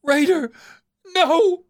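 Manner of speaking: a high, desperate scream of a mother; anguished cry. Acted. Mother sees her cub dying.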